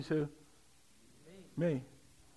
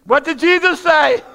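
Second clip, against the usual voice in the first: high, loud and slow, Jesus stretched; louder preaching; 8 semitones higher, 14 dB louder than usual. Shown as a demonstration. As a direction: draw it out as a ringing cry. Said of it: "I can't say it like this".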